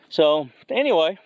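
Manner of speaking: winded, gasping between phrases; out of breath from the walk. Real, not acted. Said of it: "I'm sorry that I'm out of breath".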